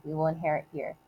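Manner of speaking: normal talking voice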